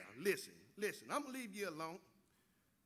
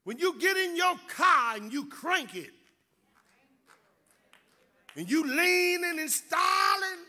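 loud, high, fervent preaching shout; preaching at full force; 9 semitones higher, 7 dB louder than usual. Acted. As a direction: drive it home to the congregation. Preacher exhorts the congregation.

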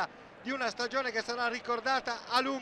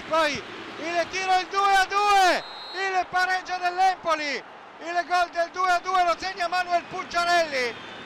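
higher, louder goal call; breathless italian goal commentary; 4 semitones higher, 4 dB louder than usual. Real, not acted. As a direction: shout the equaliser in a rush.

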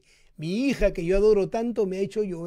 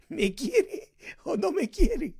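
puzzled father; 7 semitones higher, slower than usual. Retold or imitated.

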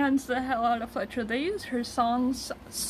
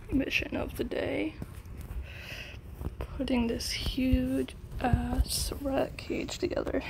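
hushed whispered narration. Real, not acted. Vlogger narrating the day's task in a whisper. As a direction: whisper it to the camera.

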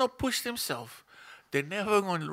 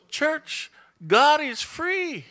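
much higher and louder, slow on key words; full-force preaching; 10 semitones higher, 9 dB louder, slower than usual. Acted. Pastor rallies the church, loud and high preaching. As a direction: lift the church with a ringing call.

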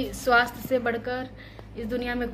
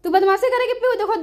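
half-playful scold; mock scolding; 10 semitones higher, 7 dB louder, faster than usual. Real, not acted. Scolding toddler eating dirt.

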